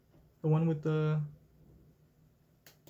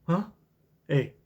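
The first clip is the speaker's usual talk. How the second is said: ordinary talk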